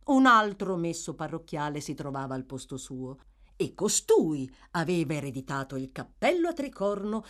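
ordinary delivery

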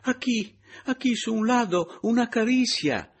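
put-on high, loud, excited foreign accent; a flustered Spanish woman exclaiming; excited Spanish lady. A narrator's reading. Pepita cries out at a touch in the dark.

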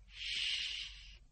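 breathy shushing sound; soft hush. A narrator's reading. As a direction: shush them gently. Narrator hushes for secrecy.

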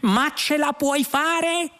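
very high, drawn-out cry; shouting. A narrator's reading. Lunqual asks whether the computer can manage, shouting. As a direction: cry out desperately, voice soaring. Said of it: "gridò Lunqual".

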